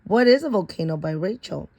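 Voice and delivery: ordinary delivery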